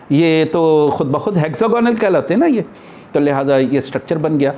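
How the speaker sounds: ordinary talk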